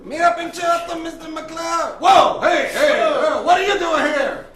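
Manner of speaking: loud, high, hammed-up Mexican accent; Mexican-accented Socrates. Socrates taunts opposing lawyer McCloud, loud Mexican accent. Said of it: "I don't know why I'm Mexican".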